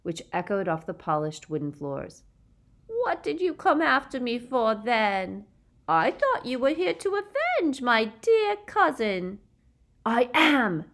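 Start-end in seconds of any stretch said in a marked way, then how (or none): 2.9-9.4 high, loud taunting coo; sneering babyish lilt; mock baby voice. A narrator's reading. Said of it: "her mock baby voice". 10.1-10.8 loud, high defiant shout. A narrator's reading. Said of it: "shouted Harry".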